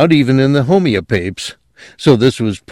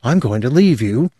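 controlled ultimatum, held in check; level, controlled; 4 semitones higher than usual. A narrator's reading. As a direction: keep it even while giving a warning. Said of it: "in a level, controlled voice".

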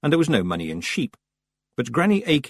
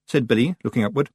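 ordinary delivery, nothing marked

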